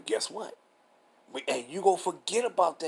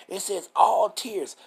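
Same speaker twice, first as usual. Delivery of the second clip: "all" punched louder and higher; raised, drawn-out "all"; stress on ALL; 6 semitones higher, 5 dB louder, much slower than usual. Retold or imitated. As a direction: quote the objection, slowly stretching "all tears". Quotes objectors leaning on "all.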